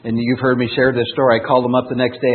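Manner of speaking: ordinary talk, nothing marked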